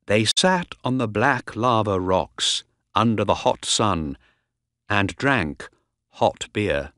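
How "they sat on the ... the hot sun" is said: ordinary delivery, nothing marked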